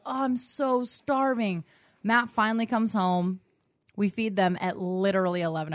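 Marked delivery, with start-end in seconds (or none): 0.1-1.7 higher, drawn-out mock complaint; starving little nieces. Niece complaining to her aunt late at night, mock starving. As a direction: stretch it out like hungry kids pleading. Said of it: "They're like".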